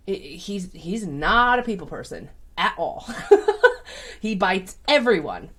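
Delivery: ordinary talk, nothing marked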